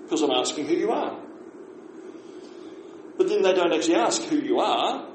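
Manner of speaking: ordinary talk, nothing marked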